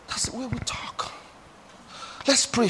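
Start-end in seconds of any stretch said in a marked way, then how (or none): 0.1-1.1 whispered aside to the church. Acted. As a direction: lean in and whisper it. Preacher drops to aside.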